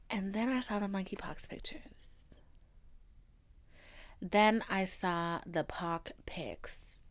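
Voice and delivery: whispered horror at the pictures. Real, not acted. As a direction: breathe the words out in dread. Dread at the pictures.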